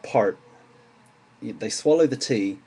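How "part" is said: American "part", strong R, swallowed T; "part" with an American accent, the T swallowed. Shown as a demonstration. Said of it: "With an American accent, that would be more like".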